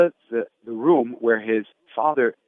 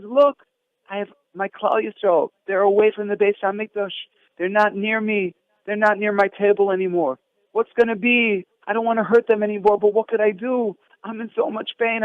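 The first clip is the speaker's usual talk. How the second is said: pained plea; 6 semitones higher than usual. Retold or imitated. Voicing Hashem in pain.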